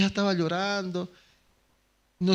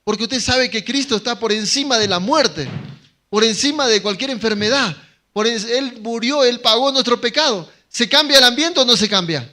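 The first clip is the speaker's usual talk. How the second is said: predicación con fuerza; 4 semitones higher, 5 dB louder than usual. Acted. Preaching Christ over death.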